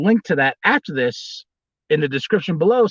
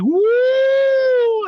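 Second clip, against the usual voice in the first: shrill high crowd-pumping woo; Screaming Puma woo. Acted. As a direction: whoop it out as a masked wrestler. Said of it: "the Screaming Puma came out and was like".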